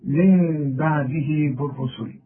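من بعده held slow with the ghunna drawn through the nose; nasal ghunna. Shown as a demonstration. Quran teacher demonstrating ghunna to a student. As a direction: recite من بعده بالرسل slowly, humming the ghunna on the noon. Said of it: "طلع لي الغنة من الخيشوم".

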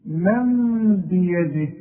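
من بيده with a long nasal meem hum, slow; iqlab ghunna. Shown as a demonstration. Teacher recites example for class, slow ghunna. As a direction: drag out the ghunna on من. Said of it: "شوف".

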